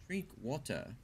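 fake British accent. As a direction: put on a British accent, softly. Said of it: "I'll do the fake British accent".

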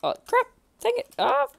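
high, sharp cry of dismay; real high-pitched alarm; startled yelp. Real, not acted. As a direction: yelp as it goes wrong. Sudden danger in game.

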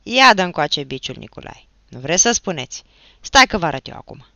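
loud, punched orders; angry, forceful bursts; menacing command. A narrator's reading. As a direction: demand it and threaten them loudly. Achim demands whip, threatens boys.

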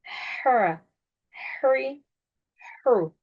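"Her, hurry, hu" with breathy mid-throat h and thin vowels; short separate syllables "Her, hurry, hu", h from the throat; vowels of "Her, hurry, hu" between back and front. Shown as a demonstration. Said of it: "Şimdi doğrusunu okuyorum".

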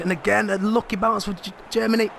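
tense build to a high peak; excited commentary at the chip; rising excitement. Real, not acted. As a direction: pitch climbing high as the chance unfolds. Tense chance in front of goal.

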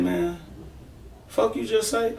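high, sharp retold challenge, a touch mock; confronting challenge. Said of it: "I said, I said".

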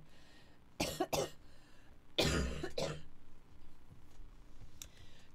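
long fit of hard coughs; real, drawn-out bout of coughing. Real, not acted. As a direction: cough repeatedly between silences. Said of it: "with that cough".

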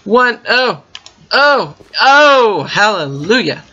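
high, loud burst of oh's; thrilled shouts ending in hallelujah; ecstatic cry. Real, not acted. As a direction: cry out in sheer joy. Finds crops growing.

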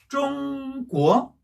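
"쫑꾸어" with high held "쫑", then rising "꾸어"; first-second tone. Shown as a demonstration. Teacher joining the syllables, "쫑꾸어" slow, level then rising. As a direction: slowly hold "쫑" high, drop and lift "꾸어". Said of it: "연이 읽으면 어떻게 되죠".

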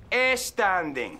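"e standing." with an added e; wrong e-standing. Shown as a demonstration. Teacher contrasts the wrong Spanish-style version. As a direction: say the e before the s.

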